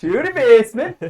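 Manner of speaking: put-on high, loud Norwegian-accented boom. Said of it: "in a Norwegian accent".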